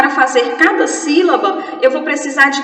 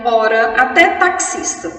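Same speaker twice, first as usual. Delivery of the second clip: ordinary talk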